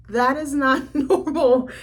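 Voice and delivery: slow, laughing through the words; laughing disbelief. Retold or imitated. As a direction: laugh it out, can't believe it. Reacting to creepy cat shrine.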